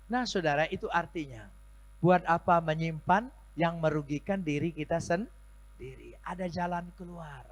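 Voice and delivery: high, loud sermon; full-force preaching. Acted. Pastor exhorting the congregation, high and loud. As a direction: rouse the congregation.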